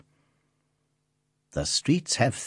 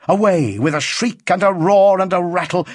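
louder, driving storyteller refrain; excited narration; 7 semitones higher, 5 dB louder than usual. A narrator's reading. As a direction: thunder it out like a train.

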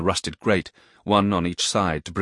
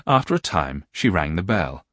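ordinary delivery, nothing marked

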